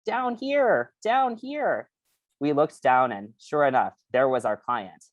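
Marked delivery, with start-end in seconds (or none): 0.0-1.9 very high, squeaky little voice; tiny squeaky brain voice. Acted. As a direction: squeak it out from the floor. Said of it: "we heard a squeaky voice say".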